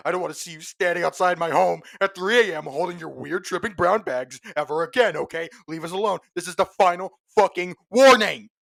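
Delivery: worked-up threat that climbs into a yell; furious rant. Acted. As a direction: rant hotly, building to a shouted final warning. Furious rant in acted reading.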